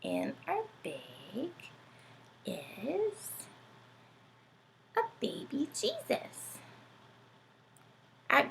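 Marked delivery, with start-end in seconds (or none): 0.0-6.6 soft, rising whisper of the answer; teacher's whispered reveal of the hidden object; hushed reveal. Acted. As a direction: build a hushed surprise.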